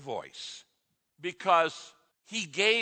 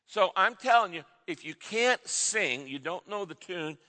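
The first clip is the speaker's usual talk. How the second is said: ordinary delivery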